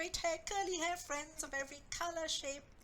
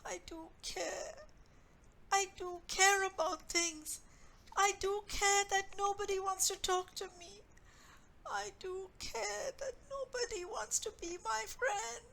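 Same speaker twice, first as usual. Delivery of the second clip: crying, voice catching, higher; tearful; 7 semitones higher, 4 dB louder than usual. Acted. Anna crying to her mother about having no friends, tearful. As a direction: plead through tears.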